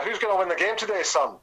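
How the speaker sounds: high, quick Scottish lilt; broad Scottish accent. Retold or imitated. Captain asking a young mascot about the match, broad Scottish accent. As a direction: imitate a broad Scottish footballer. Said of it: "quite a broad Scottish accent".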